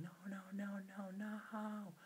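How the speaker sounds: screaming. Acted. King carried off by the bird, screaming no. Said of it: "the king was screaming".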